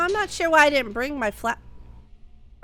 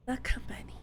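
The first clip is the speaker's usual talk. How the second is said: whispered; 8 dB quieter than usual. Pretend. Chant of company name.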